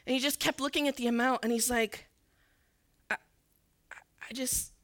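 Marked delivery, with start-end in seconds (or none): 3.1-4.7 choked up. Retold or imitated. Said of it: "he had tears coming".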